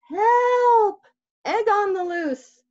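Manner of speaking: high, loud call for help; panicked shout. Acted. Bear chasing the egg, shouting for help. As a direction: cry out for help. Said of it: "shouted Bear".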